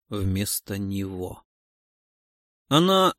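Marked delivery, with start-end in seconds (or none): 0.1-1.4 вместо него fading to a soft, slow echo. A narrator's reading. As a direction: let вместо него die away slowly. Words echoing in her head.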